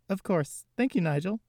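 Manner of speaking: put-on high, polite young woman; Jessie's voice. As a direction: answer sweetly and politely. Jessie answers butler.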